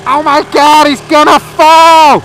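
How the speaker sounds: high, loud, slow shriek of alarm; mock the screaming bystanders, stretched out; shrieking onlookers. Mocking American bystanders watching him dangle, shrill alarm. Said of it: "all these American hags were, like, going".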